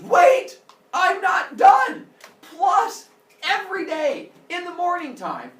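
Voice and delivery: high, loud, slow triumphant yell; gloating shout. Acted. Zeus yells the extra torture at chained Prometheus, gloating. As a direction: bellow the punishment with glee.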